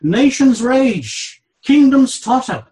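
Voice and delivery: high and loud, "Nations rage" drawn out slowly; dramatic reading. Acted. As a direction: proclaim the turmoil slowly and loudly. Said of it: "notice the contrast he draws".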